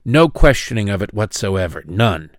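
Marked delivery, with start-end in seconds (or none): none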